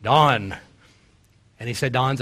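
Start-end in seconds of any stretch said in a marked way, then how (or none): 0.0-0.6 strong call. Retold or imitated. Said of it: "Ligon's got a strong voice".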